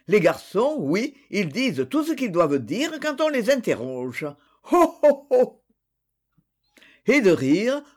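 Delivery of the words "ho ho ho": high, loud hen-like cackle of a laugh; loud high gloating chuckle; clucking laugh. A narrator's reading. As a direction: cluck out the laugh like a hen calling her chicks. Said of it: "de glousser comme une poule".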